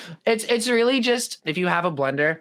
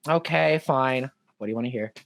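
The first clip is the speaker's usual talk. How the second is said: low, sinking, resigned reply; imitated weary compliance; 6 semitones lower than usual. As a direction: give in reluctantly, voice dropping away. Acts girlfriend asked to perform languages.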